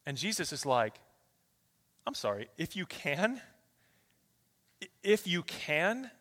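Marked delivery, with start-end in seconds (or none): 2.1-6.1 soft, rising disbelief; pointed, quiet echo with a questioning lift; incredulous questioning. Acted. Jesus questioning the father's phrase.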